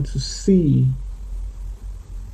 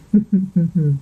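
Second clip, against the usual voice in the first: short laugh; 3 semitones higher than usual. Real, not acted. Laughs at own slip.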